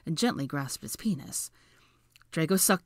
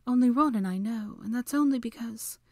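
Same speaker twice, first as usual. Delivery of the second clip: higher, fading to a whisper; choking up; 6 semitones higher than usual. A narrator's reading. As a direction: let the words catch in your throat. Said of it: "She choked up".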